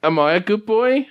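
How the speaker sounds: high, pleading talking-dog question; Sergeant Pupper. Acted. Anxious dog seeking approval, high pleading doggy voice. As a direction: ask it high and needy, rising at the end. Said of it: "Papa's is asking this question".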